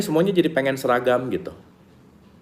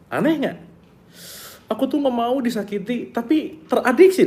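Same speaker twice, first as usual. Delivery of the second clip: high mock voice; 12 semitones higher than usual. Quoting a hurt person.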